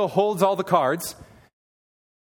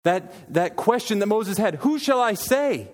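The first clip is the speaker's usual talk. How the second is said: raised voice, preaching at full force; fervent sermon climax, voice ringing higher; impassioned preaching; 5 semitones higher than usual. Acted. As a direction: lift it high and proclaim the I am.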